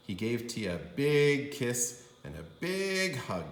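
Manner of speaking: plain reading voice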